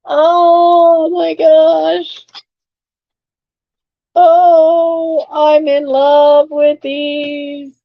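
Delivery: high, long-drawn adoring gush; head over heels, slowly gushing; swooning delight. Real, not acted. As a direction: stretch each word out in rapture. Overjoyed at a vintage find.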